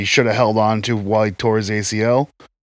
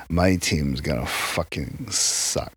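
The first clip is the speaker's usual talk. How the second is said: low, soft, slow-motion impression; Robert Kraft; 7 semitones lower, 9 dB quieter, much slower than usual. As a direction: impersonate a ponderous man in a deep hush. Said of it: "I'll do a Robert Kraft impression".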